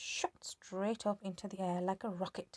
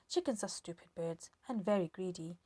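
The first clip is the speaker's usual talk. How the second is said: usual speaking voice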